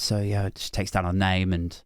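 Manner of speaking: normal talking voice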